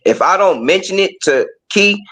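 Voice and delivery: normal talking voice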